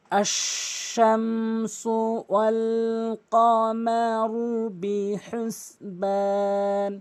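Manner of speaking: very slow chant, each vowel stretched; slow recitation. Shown as a demonstration. Teacher recites slowly first to teach fast reading. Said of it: "প্রথমে slow করে বলবো".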